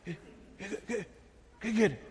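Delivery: very soft, stammered question; nervous stammer. Retold or imitated. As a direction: mimic a hushed, nervous stutter. Acting out Dani's nervous reply. (tartamudeo nervioso en voz baja)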